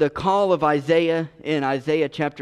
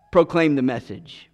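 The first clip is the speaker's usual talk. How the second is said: ordinary talk, nothing marked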